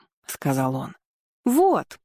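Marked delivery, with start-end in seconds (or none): 1.4-2.0 preachy. A narrator's reading. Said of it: "наставительно ткнув в его сторону пальцем".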